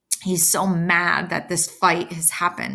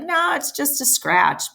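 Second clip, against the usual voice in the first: shaking it off; 8 semitones higher than usual. Retold or imitated. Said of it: "trying to first shake it off".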